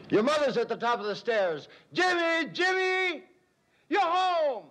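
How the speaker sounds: high, loud father's call across the house; yelling out. Retold or imitated. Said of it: "And I yell out".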